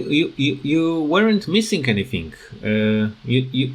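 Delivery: normal talking voice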